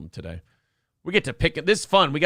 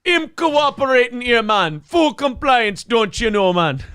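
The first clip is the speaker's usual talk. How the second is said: high, loud patois mixed with Minnesota and Irish lilts; Irish Jamaican Swede accent; 5 semitones higher, 3 dB louder than usual. Acted. Bar story of a hitman, Irish Jamaican Swede accent, high and loud. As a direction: play a gangster barfly in a mashed-up accent. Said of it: "An Irish Jamaican Swede".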